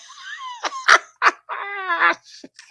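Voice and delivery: very high, shrieky cackle; long, high-pitched laughing fit; squealing laugh. Real, not acted. Cracking up at own joke.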